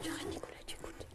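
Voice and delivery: whispered aside to a pupil. Real, not acted. Teacher whispering to Nicolas to listen, whisper. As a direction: whisper it so only he hears.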